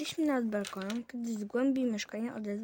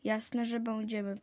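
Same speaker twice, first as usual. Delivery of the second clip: ordinary delivery, nothing marked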